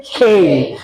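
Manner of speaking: खे with a strong puff of air; aspirated kh. Shown as a demonstration. Teacher demonstrating the aspirated खे. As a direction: breathe out hard on खे. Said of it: "के नहीं है".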